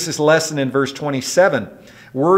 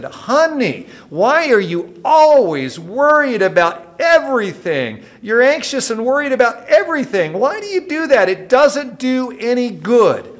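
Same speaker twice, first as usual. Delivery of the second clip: exasperated husband; 8 semitones higher, 3 dB louder than usual. Retold or imitated. Husband confronting his wife about her constant worrying, exasperated. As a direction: play the fed-up husband begging her to stop.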